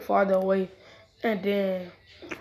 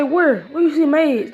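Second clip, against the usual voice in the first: higher, louder alarmed question; 6 semitones higher, 6 dB louder, slower than usual. Acted. Naruto to friends who called his wives maids, startled. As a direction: jump in, startled.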